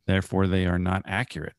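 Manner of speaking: ordinary talk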